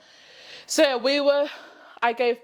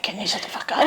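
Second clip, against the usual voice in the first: laughing whisper; faster than usual. Retold or imitated. Snapping back mid-labour, laughing.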